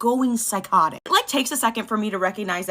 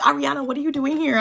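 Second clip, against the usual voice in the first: surprised; 7 semitones higher, 5 dB louder than usual. Retold or imitated. Brother surprised hearing her.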